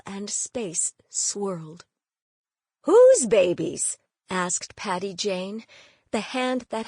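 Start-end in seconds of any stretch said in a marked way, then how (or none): 2.8-4.0 stunned loud question. Acted. Aunt stunned by the news.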